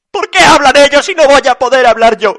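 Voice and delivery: high, very loud protest; indignant dog. A narrator's reading. Stalin the dog defending his right to talk, loud indignation. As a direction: shout the complaint as one aggrieved burst.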